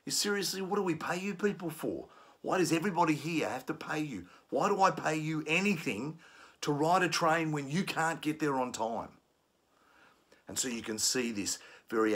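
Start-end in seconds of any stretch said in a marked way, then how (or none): 0.1-9.1 agitated challenge. Shown as a demonstration. Trainer acting an agitated commuter challenging train staff angrily. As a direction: demand answers, getting heated. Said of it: "they might start challenging you".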